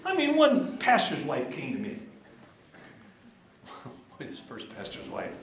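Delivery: ordinary talk, nothing marked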